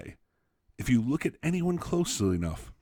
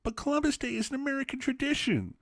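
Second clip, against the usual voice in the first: much higher, squeaky mock voice of a clueless man; mocking ignorant man. Essay mocks an ignorant Oklahoma man, much higher mocking voice. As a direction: lampoon him in a high silly voice.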